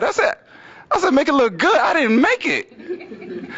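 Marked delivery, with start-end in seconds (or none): 1.1-3.5 high-pitched outraged disbelief; incredulous echo. Retold or imitated. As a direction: throw it back as an indignant question. Incredulous echo of her compliment.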